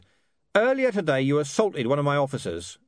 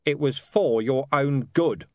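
growled; 6 semitones higher, 4 dB louder, slower than usual. A narrator's reading. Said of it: "Vimes growled".

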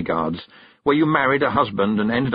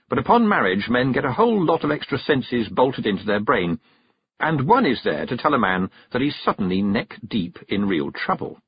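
plain reading voice

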